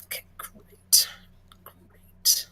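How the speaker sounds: breathy whispered okay. Real, not acted. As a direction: whisper it under your breath. Unprompted whispered reply.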